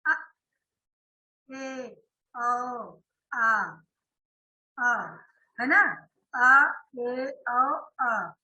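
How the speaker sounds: अ ए ओ sounded separately with the mouth half open; half-open vowel demo. Shown as a demonstration. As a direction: model each half-open vowel for the class. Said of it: "मुख आधा खुलता है".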